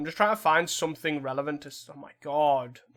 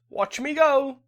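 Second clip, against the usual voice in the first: cocky boast; 10 semitones higher, 7 dB louder, slower than usual. Real, not acted. Cocky boast before a play.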